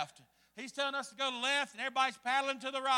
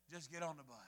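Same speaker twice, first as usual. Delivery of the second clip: much lower and softer, understated; low, quiet reply; 9 semitones lower, 15 dB quieter than usual. Retold or imitated. Guide brushing off a worried rafter, low and quiet. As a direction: imitate a guide's quiet, unbothered answer.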